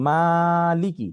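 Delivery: মালিকি read very slowly, the "মা" gently drawn; light madd. Shown as a demonstration. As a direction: stretch the "মা" of মালিকি a little in recitation. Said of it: "এত বেশি টান হবে না". (মালিকি শব্দের "মা" অল্প টেনে ধীরে পড়া)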